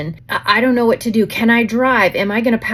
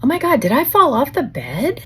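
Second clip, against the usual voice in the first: shocked disbelief; 7 semitones higher than usual. Retold or imitated. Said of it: "I kinda look around and I'm like".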